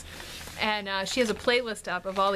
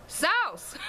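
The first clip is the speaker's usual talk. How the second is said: high-pitched imitated Southern "sauce", like "sowss"; mock Southern "sauce" with a gliding vowel; Southern accent; 8 semitones higher than usual. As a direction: mimic a thick Southern "SOWSS". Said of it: "Like that, that was what they did".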